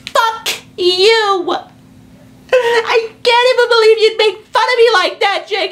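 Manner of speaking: squeaky outraged mother voice; offended mom. Retold or imitated. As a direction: play the mom yelling back, sure she's being mocked. Mom thinks he mocks her.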